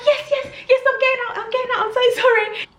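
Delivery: high, hurried mock panic; high, panicked gabble; flustered. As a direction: imitate someone apologising while scrambling out. Acting the intruder's flustered exit.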